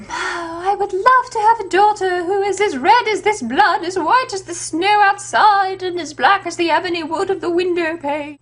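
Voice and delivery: mock-wistful mother; dreamy queen. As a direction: sigh it out like a lovesick queen. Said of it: "she goes like".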